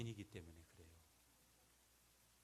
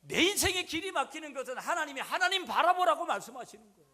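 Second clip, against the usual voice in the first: much higher and louder, ringing proclamation; fervent preaching shout; 14 dB louder than usual. Real, not acted. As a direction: cry it out over the crowd. (높고 크게 외치는 설교)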